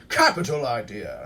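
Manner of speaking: a hint of a grand British accent; lightly voiced grand Brit. Podcaster requotes the coach's line in a mild grand British accent. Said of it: "this very grand kind of way of speaking".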